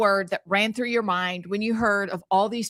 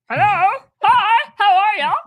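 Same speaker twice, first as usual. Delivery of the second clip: chicken voice; 11 semitones higher, 8 dB louder than usual. Retold or imitated. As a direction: greet everyone like a chirpy chicken. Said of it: "my chickens are like".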